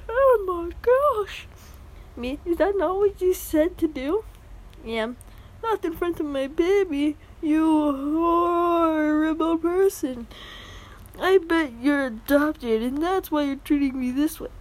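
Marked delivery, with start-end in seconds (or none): 0.1-1.5 drawn out, much higher "oh my gosh"; slow shocked falsetto gasp. Retold or imitated. Em shocked at rabbit. 2.5-4.2 higher, faux-innocent question; higher pitched mock innocence. Fake innocent question to mom. 5.6-14.5 hammed-up furious entitled mother; entitled mom accent. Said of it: "Sorry about the horrible accents".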